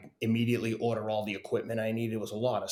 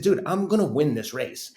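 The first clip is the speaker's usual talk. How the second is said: louder, higher cocky declaration; retold bold boast; 5 semitones higher, 7 dB louder than usual. Telling a friend he will beat everyone, brash confidence. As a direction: insist on it to a doubter.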